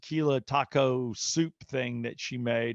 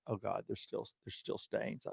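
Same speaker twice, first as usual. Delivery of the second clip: whispered, much softer; hushed dismay; 4 semitones lower, 15 dB quieter than usual. Retold or imitated. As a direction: breathe it out in horror. Wife dismayed about stains.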